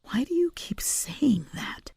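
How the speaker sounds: hushed, pressing question; whispered demand. A narrator's reading. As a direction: demand it under her breath. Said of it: "demanded the mouse".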